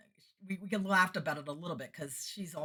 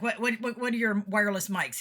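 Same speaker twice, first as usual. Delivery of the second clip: loud, higher, stammered acted query; insistent questioning; 6 semitones higher, 8 dB louder than usual. Sound guy quizzing singer about gear, loud and insistent. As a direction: press for an answer as the tech.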